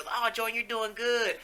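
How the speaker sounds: mock cheery praise. Quoting people's praise.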